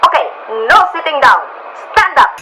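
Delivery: put-on loud, high Italian-accented orders; commanding Italian accent. Italian stranger making the crying tourist stand up, commanding Italian accent. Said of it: "My Italian accent is so bad".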